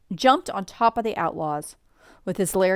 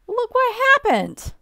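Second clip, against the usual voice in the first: high, sustained, louder call; excited reveal; 6 dB louder than usual. A narrator's reading. Storyteller revealing the long-wished-for event, excited reveal. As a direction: announce it as a big surprise, pitched way up.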